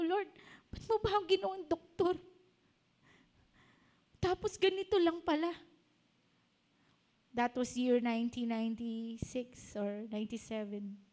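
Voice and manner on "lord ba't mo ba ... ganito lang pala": high-pitched lament to God, re-enacted; mock-tearful complaint, pitched well up; retold tearful plea. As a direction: imitate crying out in prayer, without extra volume. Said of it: "Iyak ako ng iyak. Sabi ko".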